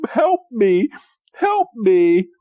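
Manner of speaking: high, copied whimpering plea; mimicked plea for help. Mime Junior copying James, high mock plea. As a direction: echo the teacher's fake cry for help. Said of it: "Mime Junior mimics him".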